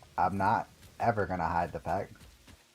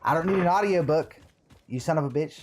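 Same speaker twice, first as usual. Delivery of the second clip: mock anger; 7 semitones higher, 5 dB louder, faster than usual. Mock insult, then "just kidding.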